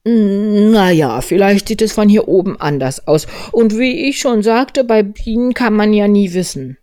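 high, loud storybook bear, musing; Winnie der Pooh. Acted. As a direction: reason it out from up a tree. (hohe, laute Bärenstimme, nachdenklich)

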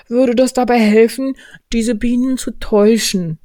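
high, loud bear, earnestly scheming; Winnie der Pooh. Acted. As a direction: explain the plan from up high. Voices Pooh in story. (hohe, eifrige Bärenstimme)

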